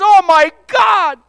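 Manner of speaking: a dismayed cry of being found out; shocked exclamation. Retold or imitated. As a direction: gasp it as the truth lands. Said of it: "David says".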